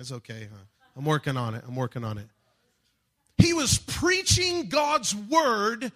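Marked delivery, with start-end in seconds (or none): 3.4-5.9 high, slow and loud; preaching shout. Real, not acted. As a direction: shout it out, full preacher force.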